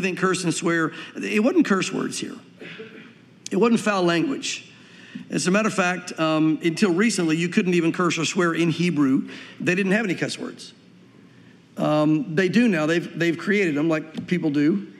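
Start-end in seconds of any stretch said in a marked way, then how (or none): none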